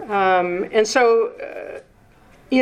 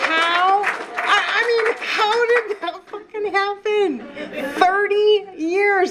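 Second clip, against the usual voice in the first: amazed disbelief; 11 semitones higher than usual. Real, not acted. Sharing her thirty clean years with the meeting, higher incredulous laughing disbelief.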